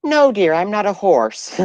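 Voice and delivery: put-on high, slow sweet old lady. Woman pranking a telemarketer as a high old lady. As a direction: answer like a dear little granny. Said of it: "the old lady voice".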